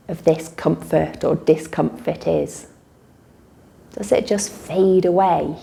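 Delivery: calm guided relaxation with long pauses; guided meditation. Real, not acted. Teacher leading listeners in a body-awareness relaxation, unhurried meditation voice. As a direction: guide them gently through noticing breath and body.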